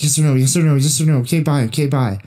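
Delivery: high, loud, relentless mock pestering; nonstop rapid-fire demand. Friend imitates erratic man on the phone, loud nonstop demanding. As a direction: repeat it without missing a beat. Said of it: "without missing a beat".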